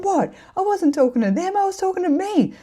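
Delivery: high, loud, defensive squeal; indignant protest. Retold or imitated. Defending herself to Mel.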